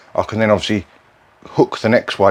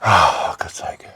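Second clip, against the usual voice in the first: frustrated whisper; 3 semitones lower, much slower than usual. Real, not acted. Frustrated by missing joist marks.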